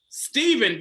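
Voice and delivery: ordinary delivery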